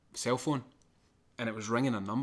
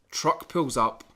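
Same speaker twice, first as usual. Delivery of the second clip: usual speaking voice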